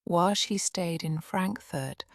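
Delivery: usual speaking voice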